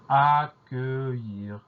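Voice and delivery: "accueillir" stretched very slowly, syllable by syllable; slow teacher's enunciation of "accueillir"; drawn-out dictation. Shown as a demonstration. Dictation teacher presents the word.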